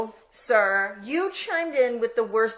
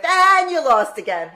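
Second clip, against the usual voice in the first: mock gloat; 5 semitones higher, 7 dB louder than usual. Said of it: "I start the show with".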